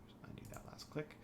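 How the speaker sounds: quick whispered aside; whispering. Real, not acted. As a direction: whisper it fast to yourself. Muttering while fixing a click.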